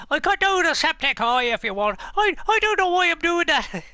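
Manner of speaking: very loud, high-pitched manic Irish YouTuber; Septic Eye impression. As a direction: shout it in an exaggerated, high Irish voice. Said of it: "a septic eye, that's him".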